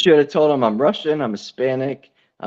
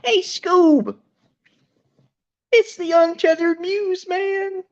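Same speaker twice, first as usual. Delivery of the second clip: very high cartoon slacker voice; high-pitched, throat-straining goofball; Shaggy; slower than usual. Shown as a demonstration. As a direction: squeak it up like a goofy cartoon dude. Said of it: "I do Shaggy".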